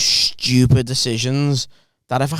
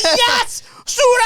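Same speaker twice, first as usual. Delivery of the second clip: much higher mock call back; retold shout; 3 dB louder, much slower than usual. Teen shouting back at the pizza lads, mock shout.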